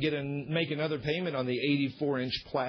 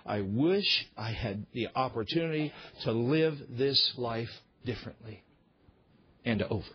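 regretful; 5 semitones lower than usual. Acted. Preacher voicing Solomon wishing he had lived differently, regretful. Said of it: "the tone of what Solomon is saying".

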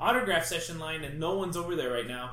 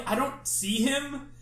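normal talking voice

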